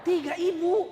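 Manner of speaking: Tiga ibu, ibu high and loud; stress on ibu. Shown as a demonstration. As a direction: correct the crowd, punch ibu. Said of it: "Saya tidak bilang tiga istri".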